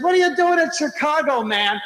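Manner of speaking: high, eager question to a stranger; excited question. Retold or imitated. Stranger at bus stop greets a famous lecturer, excited. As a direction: voice jumps up in friendly surprise.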